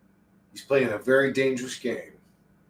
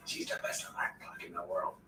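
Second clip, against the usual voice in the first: soft whisper, no voice; 8 semitones lower, 16 dB quieter than usual. Real, not acted. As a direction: breathe the words out. Repeated in whisper.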